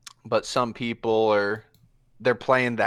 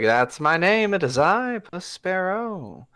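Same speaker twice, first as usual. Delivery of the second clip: higher, slowing on the name with flourish; grand self-introduction; 8 semitones higher than usual. Acted. Puss Sparrow to the cloaked man, grandly naming himself. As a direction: announce yourself like a dashing rogue.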